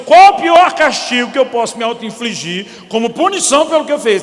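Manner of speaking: hammed-up guilty husband thinking aloud; mock self-punishment. Imagined husband's self-punishing thought.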